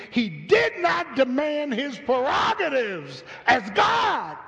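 high, loud scripture preaching. Acted. Preacher proclaiming Philippians to the church, loud. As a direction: proclaim the verse.

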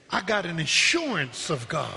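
ordinary talk

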